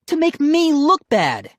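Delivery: high, loud accusation; angry retort. A narrator's reading. Brother answering sister in quarrel, angry. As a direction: throw it back at her loudly.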